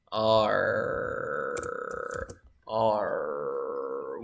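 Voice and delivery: "R" held long on the voice; voiced R sound. Shown as a demonstration. Teacher quizzing the class on R, long voiced R. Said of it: "What about the R?".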